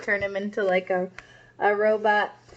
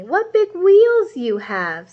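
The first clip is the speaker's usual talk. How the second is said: wondering scooter; 8 semitones higher, 6 dB louder, slower than usual. Acted. Little Red questions disguised Granny.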